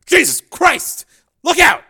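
panicked scream. A narrator's reading. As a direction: scream the warning. Men warn of grenade.

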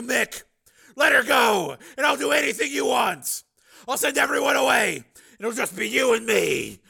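high, very loud, urgent bargaining; shouted plea. Acted. Man bargains for a girl's release, shouted plea. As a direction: shout it across a distance, pleading.

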